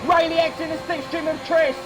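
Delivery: high, shouted race commentary; live play-by-play. Real, not acted. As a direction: call turn one chaos at full pitch.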